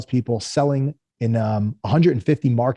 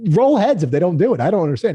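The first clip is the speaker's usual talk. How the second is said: exasperated; 6 semitones higher, 4 dB louder than usual. Retold or imitated. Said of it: "I'm like".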